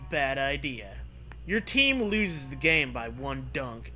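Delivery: ordinary talk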